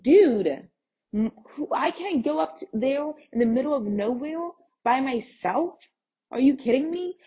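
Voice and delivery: high-pitched exasperation, rising questions; incredulous protest. Retold or imitated. Refusing seller's plea to come alone, incredulous. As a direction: protest in disbelief, voice climbing.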